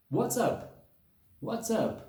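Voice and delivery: "What's up?" popped out high and short, like a hiccup. Shown as a demonstration. Teacher showing students the hiccup-like bounce of "what's up". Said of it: "como se fosse quase um soluço".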